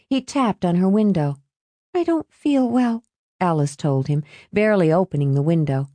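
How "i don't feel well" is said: high-pitched feeble excuse; reluctant girl. A narrator's reading. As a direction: beg off in a girl's high voice. Girl excuses herself to her date.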